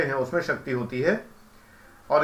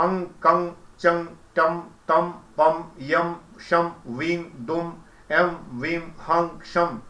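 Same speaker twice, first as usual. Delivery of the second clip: slow seed syllables, each set apart by a pause; syllable-by-syllable recitation; much slower than usual. Shown as a demonstration. Teacher showing how seed mantras come, slow separated recitation. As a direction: chant one syllable at a time, slowly. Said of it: "जब इस तरह से आता है".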